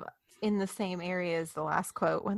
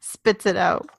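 normal talking voice

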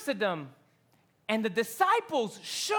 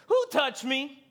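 urgent call out; 3 semitones higher, 5 dB louder than usual. Acted. Jesus calls out in crowd.

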